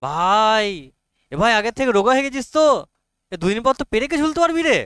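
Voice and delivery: high, loud, quick teasing praise; mock admiration. Teacher acts out ribbing a friend about weight, mock-admiring. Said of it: "আমি ওর বিশাল প্রশংসা করলাম".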